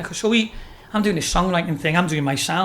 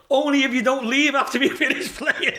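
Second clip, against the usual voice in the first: high-pitched plea broken by laughter; laughing retold quote; 8 semitones higher than usual. Young singer begging the crowd to stay, voiced through laughter. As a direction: imitate the singer while cracking up.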